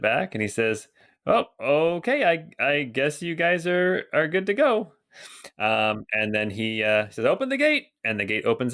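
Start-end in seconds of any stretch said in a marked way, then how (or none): 1.3-4.9 high-pitched guard reluctantly giving in; gate soldier Bert, hesitant. Acted. Guard letting the party through, high and hesitant. 7.2-7.9 high-pitched called-out order; calling out. Acted. Guard ordering the gate opened, high-pitched call.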